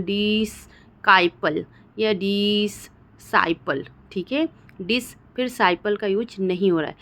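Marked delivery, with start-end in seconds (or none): 0.0-3.8 "डिस्काइपल या डिससाइपल" slowly broken after a long "dees"; slow "डिस्काइपल" with a hard k, "डिससाइपल" with a doubled s; split misreading. Shown as a demonstration. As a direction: stretch the first syllable, then hit "kaipal" in "डिस्काइपल" and "saipal" in "डिससाइपल". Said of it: "हम ऐसा नहीं बोल रहे हैं".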